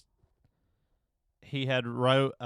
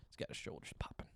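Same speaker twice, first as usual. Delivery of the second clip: whisper; 7 semitones lower, 17 dB quieter than usual. Real, not acted. Describing the creep dancing.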